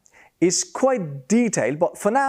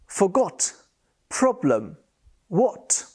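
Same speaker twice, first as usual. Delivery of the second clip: normal talking voice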